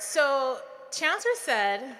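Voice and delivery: usual speaking voice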